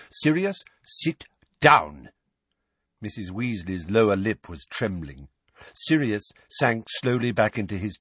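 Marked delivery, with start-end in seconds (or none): 0.1-2.1 slow, raised, firm order; sharp command. A narrator's reading. Lupin orders Sirius to sit down, sharply. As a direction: order him down, each word weighted. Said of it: "said Lupin sharply".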